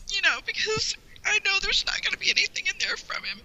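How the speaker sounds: fighting back tears; choked up. Real, not acted. Fan explaining mailbox grief, choked up.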